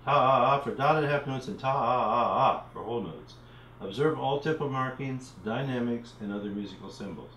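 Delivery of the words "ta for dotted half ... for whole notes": ta stretched long for half notes; drawn-out ta. Shown as a demonstration. Teacher modelling rhythm syllables, ta drawn out long. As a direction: hold the ta out like the note. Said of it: "using the syllables T".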